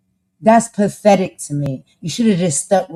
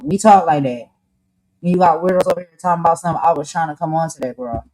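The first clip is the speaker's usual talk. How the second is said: ordinary delivery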